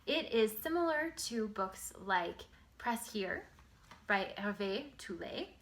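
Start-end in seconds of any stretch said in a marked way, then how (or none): none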